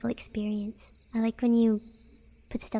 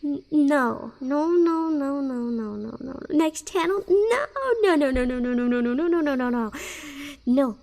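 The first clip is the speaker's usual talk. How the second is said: loud, tumbling string of pleas; mock frantic protest; 3 semitones higher, 6 dB louder than usual. Streamer fears chat will change channel, mock frantic. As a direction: wail it out in pretend panic.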